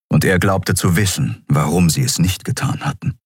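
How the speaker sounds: usual speaking voice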